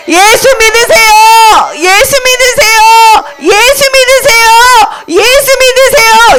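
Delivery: high, drawn-out rallying call; repeated high proclamation, vowels held; evangelist's cry. Acted. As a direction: lead children in a high slow chant. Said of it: "우리도 그럼 뭐라고 말해야 돼?".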